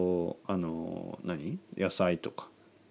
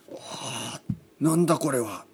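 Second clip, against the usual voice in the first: amazed power-up; 4 semitones higher than usual. Retold or imitated. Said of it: "みたいな".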